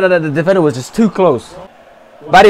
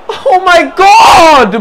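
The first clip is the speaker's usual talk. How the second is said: high, drawn-out wail; long anguished exclamation; exasperated cry; 9 semitones higher, 3 dB louder, much slower than usual. Real, not acted. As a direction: groan loudly at a wasted chance. Agonised at missed chance.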